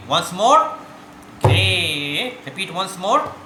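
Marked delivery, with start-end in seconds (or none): 1.4-2.3 GHE held long and firmly; drawn-out syllable call. Shown as a demonstration. Teacher demonstrates the stroke, syllable drawn out. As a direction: call out a sustained tabla syllable. Calls syllable for pupils to repeat.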